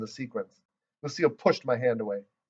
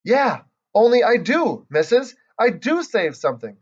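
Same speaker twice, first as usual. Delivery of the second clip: put-on eager child jumping up excited; real excited; 9 semitones higher, 5 dB louder than usual. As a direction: burst out with a sudden idea. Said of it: "I jumped up real excited".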